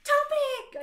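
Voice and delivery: rushed then a loud high squeal on Topic; happy. Acted. Heroine gushing about the shop to Satan, squealing. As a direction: build to a squeal of delight. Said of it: "happy again".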